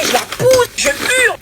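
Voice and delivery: retold shouting. Retelling shouting in audition.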